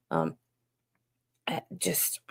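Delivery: voiceless whisper; quiet whisper while searching for words; whispered aside. Real, not acted. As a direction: breathe it out, no voice.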